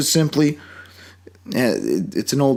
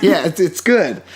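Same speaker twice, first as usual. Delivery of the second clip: louder, higher, through a real laugh; 7 semitones higher, 4 dB louder than usual. Real, not acted. Podcaster agrees with co-host after joke rant, laughing. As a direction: laugh while conceding.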